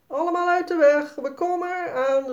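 gleeful mock-alarm call down the slide. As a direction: warn everyone on the slide. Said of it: "roept Jaspers moeder".

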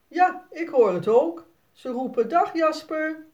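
a hint of a dad joking with his son; playful papa. (plagende vader, hoger en luider)

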